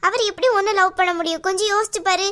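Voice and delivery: normal talking voice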